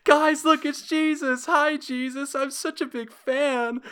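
put-on high, loud little-kid voice calling out; excited child spotting a hero; squeaky child shouting to friends; kid meme voice. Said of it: "I don't know why I did that voice".

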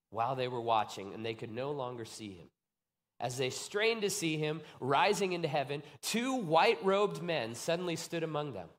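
normal talking voice

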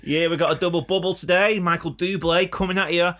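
upbeat host opening the show; podcast host intro. Acted. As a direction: announce the show with energy.

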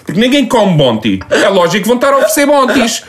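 rant, stretching the words; worked-up rant. Real, not acted. Ranting about the offer.

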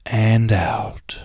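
"and out." breathed out as a whisper. Shown as a demonstration. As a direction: let "and out." go on the exhale. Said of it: "release slowly out your nose".

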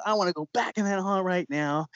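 high-pitched retold demand; imitating her insistent outburst without real volume; yelled demand. Woman insists to the haunt owner, mock yelling. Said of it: "And she's yelling".

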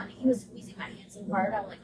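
ordinary talk, nothing marked

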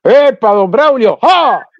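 loud, high hail and laugh like a rancher; hammed-up country man. Playing a country fellow hailing don Braulio, loud and high. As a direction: call out like a rowdy countryman. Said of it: "usted del campo".

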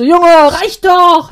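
slow, loud exasperated yell; yelling at device. Retold or imitated. Yelling at the squeezing monitor in meeting, loud and slow. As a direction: shout it at the blood pressure cuff. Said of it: "Ich so".

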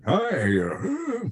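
put-on high, wavering old man's greeting; sweet old man. Retelling the old actor's struggle at the mic, elderly voice. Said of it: "he was really old and really sweet".

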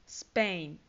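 "Spain" as one syllable, opening on s; English pronunciation. Shown as a demonstration. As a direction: model "Spain" for learners, low and falling. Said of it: "España se dice". ("Spain" pronunciado en inglés, tono descendente)

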